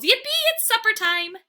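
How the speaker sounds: high, loud, bursting with joy; a dog thrilled it's supper time; excited yelp. A narrator's reading.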